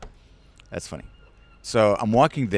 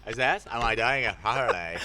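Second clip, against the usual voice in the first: mock Cockney; 8 dB quieter than usual. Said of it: "don't use the other part of their face".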